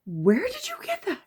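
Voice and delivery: very high-pitched disbelief; acted-out shocked interrogation; alarmed mom questioning. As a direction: squeak out the question like a worried mother. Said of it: "and I'm just like".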